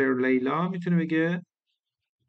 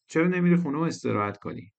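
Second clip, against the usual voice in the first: usual speaking voice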